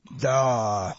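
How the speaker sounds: stretched single syllable; drawn out. A narrator's reading. Pharaoh repeats his reaction to the sleeper, drawling. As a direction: let the word hang long. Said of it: "А потом ещё раз".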